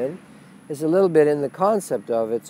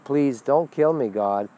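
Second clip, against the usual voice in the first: ordinary delivery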